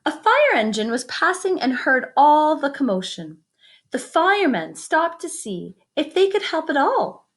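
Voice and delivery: lively picture-book reading; story narration. A narrator's reading. Teacher reading a picture book to the class, lively storytelling. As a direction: read it out to the kids with wonder.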